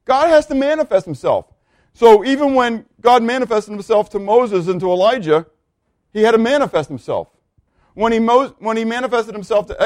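high, urgent sermon storytelling; emphatic preaching. Real, not acted. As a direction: preach it insistently, pitch held high.

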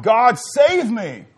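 crying out to God. Retold or imitated. Preacher retelling his prayer to God when near death: loud, slow desperate cry. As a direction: plead for rescue, drawing it out. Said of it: "And I cried out".

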